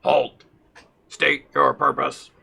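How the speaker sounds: slow monotone robotic command; a guard robot, flat and stern. Acted. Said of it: "a very monotone robotic voice".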